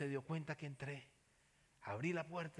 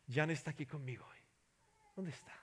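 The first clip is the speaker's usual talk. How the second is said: ordinary talk, nothing marked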